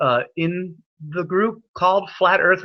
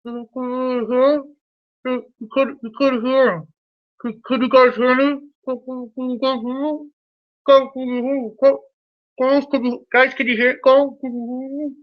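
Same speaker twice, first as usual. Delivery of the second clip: put-on high, muffled kid voice; mock a garbled kid on a bad mic, pitched way up; high-pitched imitation of an unintelligible kid, halting and repetitive; 9 semitones higher than usual. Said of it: "he was talking like this".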